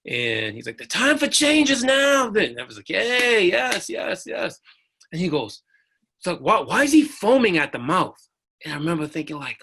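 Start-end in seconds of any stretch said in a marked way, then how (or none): 0.8-2.3 high, loud preaching cry; slow, ringing rally shout; passionate preacher. Retold or imitated. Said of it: "he's speaking with passion and he's talking". 2.9-4.6 high-pitched cheer; excited amen-style yeses. Retold or imitated. Cheering on the preacher on screen. 6.2-8.2 louder, higher indignant question; mock a baffled, annoyed sergeant; retold objection.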